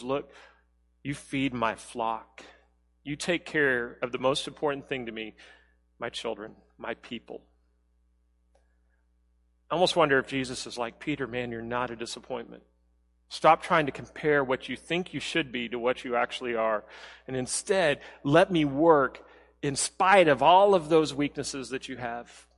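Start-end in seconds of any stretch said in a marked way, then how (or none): 0.0-7.4 a quiet, tender voice for Jesus. Retold or imitated. Jesus gently commissions failing Peter. 11.0-22.5 much softer, gently reassuring; quiet, caring comfort for Peter; reassuring Jesus. Retold or imitated.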